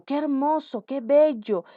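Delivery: high, admiring model exclamations; exclaiming. Shown as a demonstration. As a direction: exclaim each as a classroom example. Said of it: "Queremos exclamar". (exclamando admiración como ejemplo)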